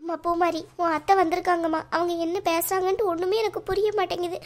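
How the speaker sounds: high, distressed mother's wail; acted weeping, overwhelmed and faint; tearful. Mother telling daughter she feels dizzy over the news, tearful. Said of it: "ஒழுங்கா நீங்க முதல்ல அழாம".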